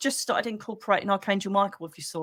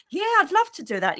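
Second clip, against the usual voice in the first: much higher, gushing mock-delighted yes; fake enthusiasm; 9 semitones higher than usual. Pretending to love a lunch invite she dreads, hammed-up fake delight. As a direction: oversell it, sugary and insincere. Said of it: "You think, "I'd rather poke my eye hole out".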